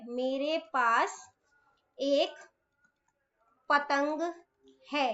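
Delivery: slow, word by word; drawn-out dictation with pauses. Shown as a demonstration. Teacher dictating a sentence to children, slow.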